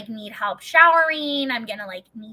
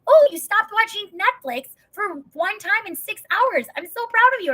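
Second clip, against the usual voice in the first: much higher and louder, sing-song praise; praising a child; 10 semitones higher, 5 dB louder than usual. Retold or imitated. Husband rewarding his wife like a little child, much higher. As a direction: overpraise her like a kindergartner. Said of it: "Or, like".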